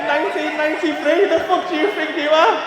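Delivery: high, loud, laughing teasing; loud laughing roast. Real, not acted. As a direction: shout the roast through laughter. Roasting friend's shirt, laughing.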